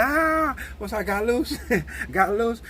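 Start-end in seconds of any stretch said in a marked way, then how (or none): 0.0-0.5 high sharp shout; fighting grunt. Retold or imitated. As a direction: let out a short high battle cry. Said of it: "I said".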